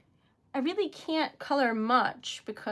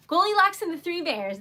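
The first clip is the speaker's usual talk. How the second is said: excited recall; 6 semitones higher, 9 dB louder than usual. Real, not acted. Remembering the fairy tale's name.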